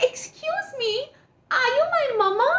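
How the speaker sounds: high, pleading little bird; high bird voice asking the butterfly; baby bird voice. A narrator's reading. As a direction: chick voice cracking with hope. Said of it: "She stopped the butterfly and said".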